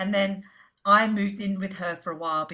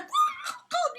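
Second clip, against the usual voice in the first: excited shout. Retold or imitated. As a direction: squeal with sudden joy. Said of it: "So I was so excited".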